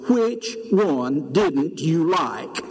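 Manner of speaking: slow, drawn-out pointed question; a slow, cheeky comeback to an angry caller; unwise smart reply. Retold or imitated. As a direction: drag each word out to needle him. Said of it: "in all of my lack of wisdom".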